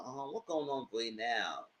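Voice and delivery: soft caring questions; soft coaxing. Retold or imitated. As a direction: coax her gently like a friend. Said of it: "I was like".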